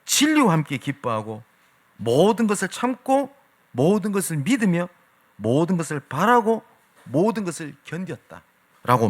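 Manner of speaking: slow, solemn reading of the scripture; unhurried, reverent Bible recitation. Acted. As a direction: read the verse slowly and solemnly, as from the pulpit. Reading scripture slowly in sermon.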